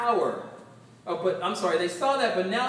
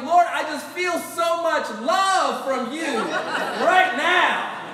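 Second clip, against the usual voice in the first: loud, slow, overjoyed cry to God; Job's ecstatic praise; 5 semitones higher, 5 dB louder, slower than usual. Retold or imitated. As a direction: shout it out as if overflowing with love. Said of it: "And Job was like".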